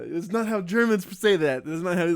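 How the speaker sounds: high, loud mock scolding German teacher; shrill-free, high loud imitation of a strict teacher scolding; teacher scolding a student's German, mock yelling; imitating teacher yelling. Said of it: "she would always yell at us".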